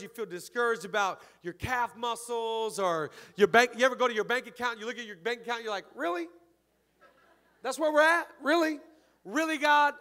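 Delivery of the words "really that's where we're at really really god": high-pitched, loud mock disbelief; incredulous complaint. Discouraged believer complaining to God over a bank balance, high and incredulous. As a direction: question it in exasperated, pleading protest.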